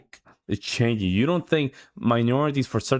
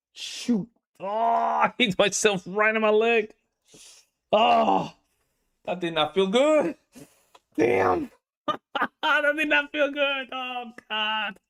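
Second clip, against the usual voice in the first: high, squealing laugh through real pain; pained laughter; 10 semitones higher than usual. Real, not acted. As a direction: laugh helplessly after hitting your leg, voice shooting up. Just hit own leg, laughing.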